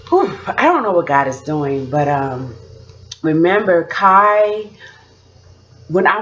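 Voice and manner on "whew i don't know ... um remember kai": normal talking voice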